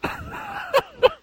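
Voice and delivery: high-pitched burst of laughter. Real, not acted. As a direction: crack up in a high cackle. Reaction to the silly letter.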